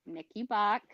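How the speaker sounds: usual speaking voice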